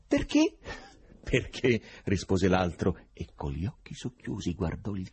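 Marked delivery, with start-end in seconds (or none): 0.1-0.6 high rising pitch; startled question. A narrator's reading. Listener challenges the foreigner's flat denial, startled question. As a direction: ask it in astonishment.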